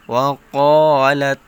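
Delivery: ওয়া ক্বালাত with the ক্বা held long and loud; four-count stretch. Shown as a demonstration. Teacher to students, demonstrating the long stretch loudly. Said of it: "চার আলিফ টান দিতে হবে".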